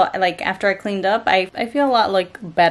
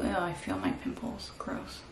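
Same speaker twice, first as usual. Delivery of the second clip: whispering; 12 dB quieter than usual. Real, not acted. Face mask on.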